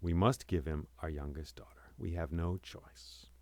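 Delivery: low, soft, resigned king; relenting. A narrator's reading. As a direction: give in quietly, with no choice left.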